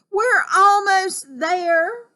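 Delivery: high, loud, long drawn-out holler; anxious call. Acted. Rescuer nearing Jubal in a sailboat, calling anxiously. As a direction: stretch it out across the water. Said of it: "an anxious voice called".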